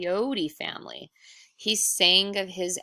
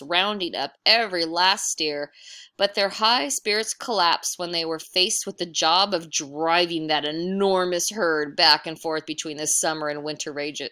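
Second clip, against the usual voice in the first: usual speaking voice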